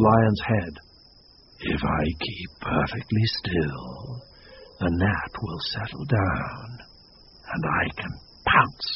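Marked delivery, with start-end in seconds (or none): 1.6-8.9 much lower and softer, fading to a whisper; a predator plotting under its breath; scheming lion thought. Acted.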